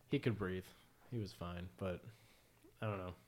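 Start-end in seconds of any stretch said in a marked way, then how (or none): none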